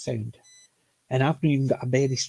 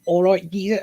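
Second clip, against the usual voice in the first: lightly put-on London geezer voice, higher pitched; geezer accent; 5 semitones higher, 4 dB louder than usual. Fan showing how the new character spoke, in a mock London geezer accent. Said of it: "the way he spoke".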